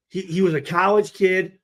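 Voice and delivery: ordinary talk, nothing marked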